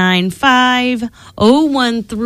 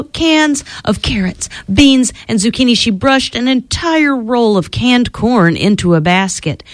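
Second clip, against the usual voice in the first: plain reading voice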